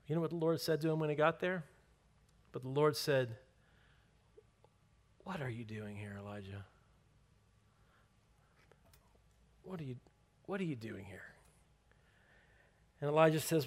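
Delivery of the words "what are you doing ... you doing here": low, very soft questioning; hushed voice of God. Acted. The Lord asking Elijah in the cave, hushed and searching. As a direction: ask it quietly, with long pauses.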